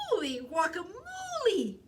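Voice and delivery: high, loud astonished kitten; excited cry of surprise; amazed exclamation. A narrator's reading. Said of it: "exclaimed Skippy John Jones".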